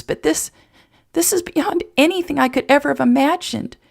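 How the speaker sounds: very high, overcome with joy; ecstatic through tears. A narrator's reading. Emma accepting life on Jason's ranch, tearfully ecstatic. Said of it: "Emma hiccuped and cried and laughed".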